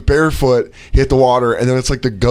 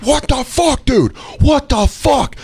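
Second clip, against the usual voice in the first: high, furious outburst; furious shout; 14 semitones higher, slower than usual. Retold or imitated. Opening door on UPS driver, furious high shout. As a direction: explode at whoever is at the door.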